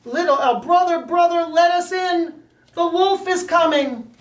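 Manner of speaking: begging brother to open up fast; frightened pigs at the door; panicked little pigs. Acted. Pigs beg brother to let in.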